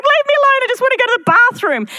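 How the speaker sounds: high, loud, fast mock whine of a cornered parent; hassled parent. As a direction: plead like a parent who just wants the bathroom. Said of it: "like a parent trying to go to the bathroom".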